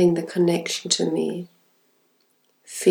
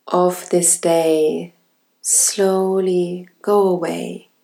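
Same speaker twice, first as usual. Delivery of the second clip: ordinary talk, nothing marked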